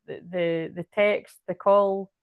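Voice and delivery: usual speaking voice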